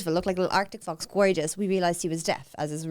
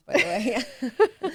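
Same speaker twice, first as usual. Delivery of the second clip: usual speaking voice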